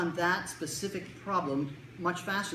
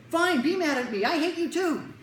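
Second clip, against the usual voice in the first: high, loud hammed-up teen fury; mock angry outburst; 12 semitones higher, 7 dB louder than usual. Kid texting silent friend, hammed-up furious outburst. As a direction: blow up dramatically, stretching into a high yell. Kid's angry text, acted.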